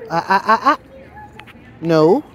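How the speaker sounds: loud sharp "ah ah ah", then a firm no; sharp correction. Real, not acted. Owner sharply stopping her dog from lunging, loud and firm. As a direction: snap the dog out of it.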